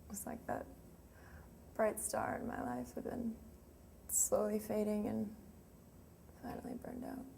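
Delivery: hushed, fading almost to a whisper; quiet mourning. Acted. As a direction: let the voice die away with grief. Mourning his slow death in monologue.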